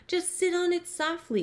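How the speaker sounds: high, gentle coaxing; sweetly persuasive bird. A narrator's reading. Maisie coaxes the elephant.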